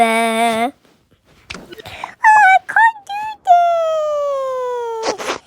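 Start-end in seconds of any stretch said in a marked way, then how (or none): 2.7-5.4 high, very drawn-out mock cry; pretend wailing. Game character giving up, long high wailing. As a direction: stretch it into a long, high wail.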